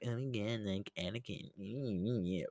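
hushed, mocking fan impression; mocking imitation. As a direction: mimic the haters under your breath, dragging it out. Mimics people who criticise Anakin.

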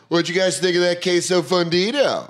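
high-pitched, hammed-up wrestler voice; Macho Man Randy Savage. Waiter asking diners about the queso, in wrestler impression. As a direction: work the table like a showboating wrestler. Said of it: "pretty good impression of Macho Man Randy Savage".

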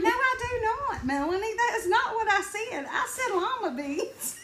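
high, loud, laughing mock protest; indignant denial. Mom denying her daughter's claim, indignant and hammed-up. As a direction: pitch it way up in exaggerated disbelief.

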